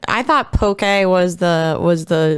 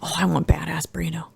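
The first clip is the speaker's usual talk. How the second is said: breathy whispered aside; 6 dB quieter than usual. Real, not acted. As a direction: whisper it under your breath.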